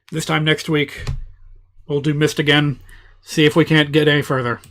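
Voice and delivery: ordinary delivery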